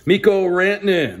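ordinary talk, nothing marked

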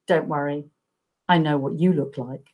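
low, soft, knowing delivery; cool reassurance. Retold or imitated. FBI agent answering the nervous writer's email, coolly reassuring.

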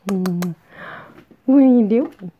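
normal talking voice

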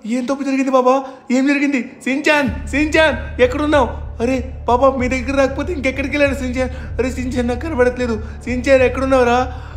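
big brother in a panic calling for Shinchan; panicked. Acted. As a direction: search desperately for your little brother.

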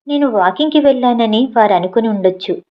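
usual speaking voice

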